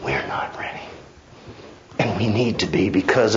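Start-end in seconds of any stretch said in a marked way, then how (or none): 0.0-0.8 whispered, much lower and softer; hushed reply. Acted. Preacher whispering the answer back to his flock. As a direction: drop to a breathy whisper for the answer.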